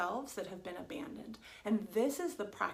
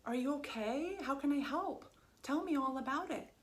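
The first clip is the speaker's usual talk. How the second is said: higher, gentle worried comfort; greeting a sobbing best friend with gentle, concerned comfort; caring concern; 5 semitones higher than usual. Retold or imitated. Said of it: "there's usually a place of".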